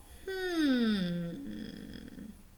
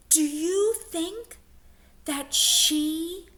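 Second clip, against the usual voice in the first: excited suspense; 5 semitones higher than usual. Acted. Teacher dramatizes twist.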